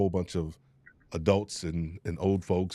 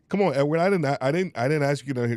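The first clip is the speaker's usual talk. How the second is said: emotional outburst; 7 semitones higher, 7 dB louder, faster than usual. Real, not acted. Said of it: "you are getting me emotional".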